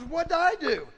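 high-pitched acted objection; indignant protest. Girl objects to God's accusation.

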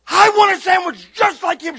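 high, loud, hammed-up demand; exaggerated shouted insistence; mock demanding customer. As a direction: imitate a customer bellowing an order.

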